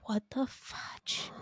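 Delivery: slow, whispered mock exclamation; husband's whisper. Husband reacting from bed, whispered and slow. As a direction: breathe it slowly in a hushed whisper. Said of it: "And Josh is like".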